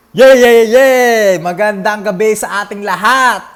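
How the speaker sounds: over-the-top emcee introducing himself; hyped show-host intro. Acted. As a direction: open the show with big, ringing hype. Opens show as excited host.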